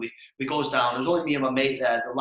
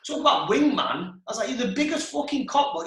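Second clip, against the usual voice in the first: mock indignation; 9 semitones higher than usual. Scolding his so-called wingman, mock indignation. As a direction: exclaim it in mock outrage. Retold row with the friend.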